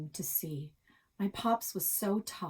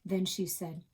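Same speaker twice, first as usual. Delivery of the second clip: plain reading voice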